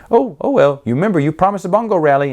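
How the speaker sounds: high, fast sly wheedle; suddenly perky and quick, reminding him of a promise; Jar Jar perking up. Retold or imitated. Jar Jar jumps up, not sick.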